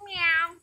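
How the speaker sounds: high mock meow; catbird meow. Teacher imitates the catbird for kids, light meow. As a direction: mimic the catbird's cry. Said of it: "sometimes says".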